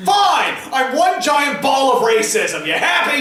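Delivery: mock outburst. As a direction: throw up your hands and yell it in fake defeat.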